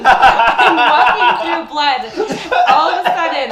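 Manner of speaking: laughing through the words, loud and slow. Real, not acted. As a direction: laugh it out in disbelief. Laughs at finding blood.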